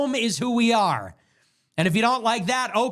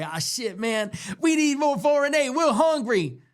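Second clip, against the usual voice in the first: higher, mocking voice of a leader asking for aid; mocking foreign leader voice; 5 semitones higher than usual. Mocking an African leader at the UN, higher and whiny. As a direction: ham up the whiny plea for more money. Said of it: "They're gonna get up and say".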